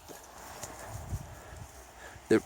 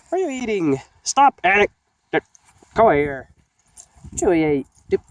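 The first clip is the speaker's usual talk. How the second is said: scolding the dog; 6 semitones higher, 5 dB louder than usual. Real, not acted. Scolding dog eating something.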